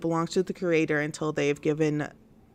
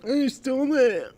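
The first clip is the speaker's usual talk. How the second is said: high, mocking sing-song; high mocking echo of the victim; mocking whiny imitation; 8 semitones higher than usual. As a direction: mimic him like a taunting kid. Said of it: "And he's like".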